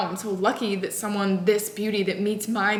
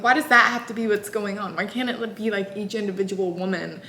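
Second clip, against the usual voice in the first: ordinary talk, nothing marked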